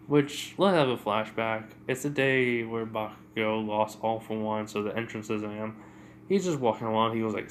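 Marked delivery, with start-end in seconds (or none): none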